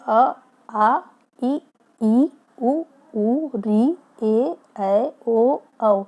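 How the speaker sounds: each Hindi vowel said slowly and clearly, mouth shaping each sound; vowel recitation. Shown as a demonstration. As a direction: sound out every vowel deliberately for the class. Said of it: "यहाँ जो मैंने pronounce किया है". (स्वरों का साफ़ उच्चारण)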